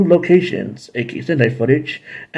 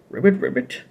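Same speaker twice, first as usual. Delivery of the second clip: frog croak; 6 semitones higher, 3 dB quieter than usual. Pretend. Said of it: "a monster called the Terror Toad".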